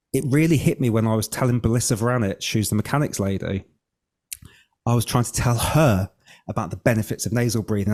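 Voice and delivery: ordinary talk, nothing marked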